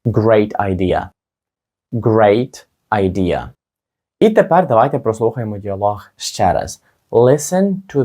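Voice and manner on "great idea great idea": very slow, Great idea drawn out; slowed for learners to repeat; teacher's slow demo of Great idea; slow model phrase. Shown as a demonstration. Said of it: "як сказати «чудова ідея»".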